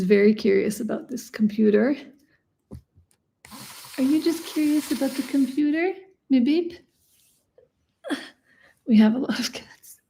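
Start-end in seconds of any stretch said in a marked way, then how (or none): 4.0-6.8 higher playful pet-talk question; cooing to a cat. Real, not acted. Talking to the cat.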